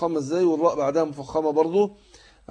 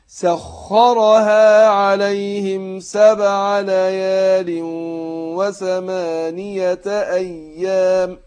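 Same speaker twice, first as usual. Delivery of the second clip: slow chanted tajweed recitation, heavy nasal hum; Quran recitation; 2 semitones higher, 6 dB louder, much slower than usual. Shown as a demonstration. Teacher demonstrating recitation to students, slow chant. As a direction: recite slowly, drawing out the ghunna.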